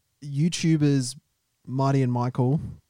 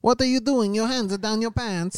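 pitched-up Borat; Borat impression; 9 semitones higher than usual. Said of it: "I'm running as Borat".

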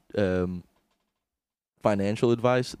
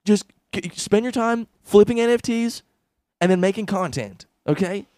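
higher, pushy hustle pitch; Gary Vee impression; 8 semitones higher than usual. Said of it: "amazing Gary Vee".

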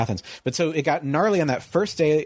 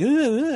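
mock grunts; 14 semitones higher than usual. Retold shoving fight scrum.

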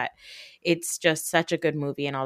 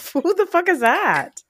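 lightly hammed-up shock; mock shocked; 3 dB louder than usual. Retelling being startled by her own Jersey accent, mock shocked. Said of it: "I was like".

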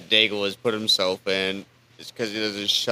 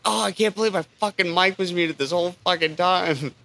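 higher-pitched mock frustration; retold exasperation; 7 semitones higher than usual. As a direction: replay your own exasperated complaint, pitched up. Retells his exasperation at muted mic.

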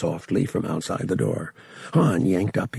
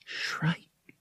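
muttered; 7 semitones higher, 9 dB quieter than usual. A narrator's reading. Boy grasping who knows his name, muttered. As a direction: mutter it under your breath. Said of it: "Han muttered".

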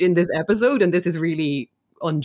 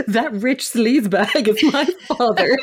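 slow, higher-pitched soap-opera revelation with laughter; Joan Collins delivery; 5 semitones higher, 4 dB louder, slower than usual. Retold or imitated. Retelling a daughter's revelation about her father, soapy melodrama. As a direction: imitate a soap diva's big reveal, amused. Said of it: "Joan Collins couldn't have delivered that line better".

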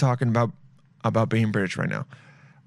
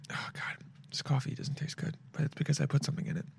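whisper; 14 dB quieter than usual. Real, not acted. Whispered aside about bad coffee.

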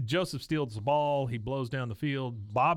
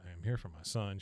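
under her breath; 4 semitones lower, 6 dB quieter, faster than usual. Retold or imitated. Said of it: "under her breath".

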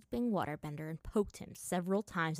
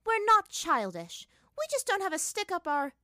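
indignant retort; 12 semitones higher, 7 dB louder than usual. Acted. Retorting to insult.

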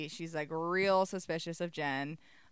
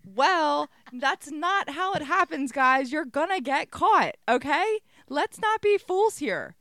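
loud, high mock lecture; theatrical scolding; 8 semitones higher, 8 dB louder, slower than usual. Recapper warning the characters they'll get caught, theatrical scolding. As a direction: call them out with big emphatic delivery.

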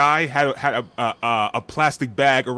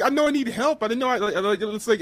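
higher retold voice; 10 semitones higher than usual. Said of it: "I was like".

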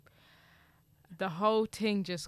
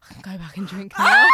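triumphant shout; 16 dB louder than usual. Retold or imitated. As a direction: announce it like a victory. Triumphant quote after vomiting.

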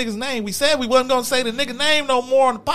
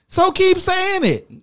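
sarcastic taunt; 10 semitones higher, 3 dB louder, slower than usual. Real, not acted.

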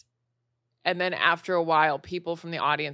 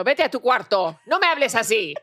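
raised, higher scolding in Spanish; louder mock telling-off; mad mom; 4 semitones higher, 6 dB louder than usual. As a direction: imitate an angry mother telling off her kid. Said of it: "when my mom gets mad at me".

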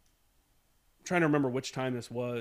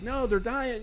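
imitating someone worked up, higher-pitched; 8 semitones higher, slower than usual. First sergeant objects to stopping the mortars, worked up. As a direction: raise the pitch in mildly agitated protest. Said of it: "obviously worked up".

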